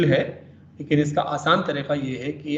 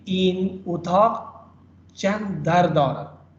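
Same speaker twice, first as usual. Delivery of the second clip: slow, چند stressed, falling to the end; slow falling statement; falling tune with stress on چند; 4 semitones higher, much slower than usual. Shown as a demonstration. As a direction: drop the tune after چند. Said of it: "یہ positive ہے تو یہ falling condition میں ہے".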